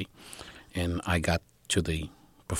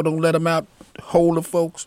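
louder, higher command; imitated urgent order; 10 semitones higher, 6 dB louder than usual. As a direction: call out to hold everyone back. Retold alarmed order to lock door.

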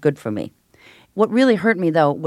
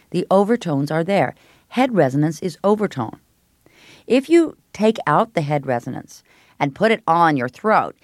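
usual speaking voice

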